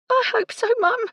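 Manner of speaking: very high, thin little maid's voice; tiny high-pitched hopeful reply; small voice. A narrator's reading. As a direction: squeeze it up high, anxious and small. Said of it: "Gertie said in a small voice".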